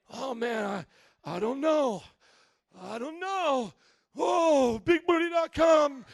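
loud, high, flustered exclamations; hammed-up tempted man. Pastor acting out a guy tempted online, hammed-up flustered yelling. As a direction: play a man overwhelmed by a pop-up ad.

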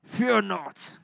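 a hint of a tough old Thor accent; mock Thor voice. Shown as a demonstration. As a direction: play the god of thunder, lightly. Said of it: "Say it like that".

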